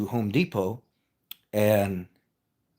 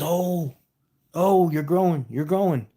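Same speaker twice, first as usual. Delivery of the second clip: high-pitched delighted astonishment; amazed joke dad; 7 semitones higher, slower than usual. Acted. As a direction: exclaim it in mock wonder, pitched up. Joke dad sees son grow.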